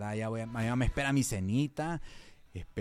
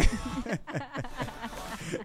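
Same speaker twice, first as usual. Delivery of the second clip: burst of laughter; 9 semitones higher than usual. Real, not acted. Laughing at the anecdote.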